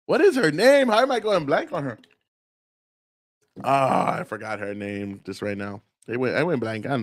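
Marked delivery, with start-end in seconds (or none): none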